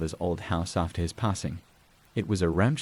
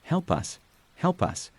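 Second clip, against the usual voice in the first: understated mock scream, pitched up; mild frantic cry; 8 semitones higher than usual. A narrator's reading. Asylum spirits begging the narrator for help, restrained frantic cry. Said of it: "the whispers turned into frantic screams".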